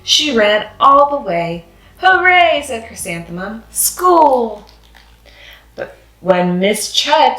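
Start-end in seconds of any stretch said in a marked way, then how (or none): 2.0-2.6 high, loud happy cheer; excited cheer. Acted. Child cheering on way to school. 3.7-4.7 excited. Acted. Child cheering on way to school.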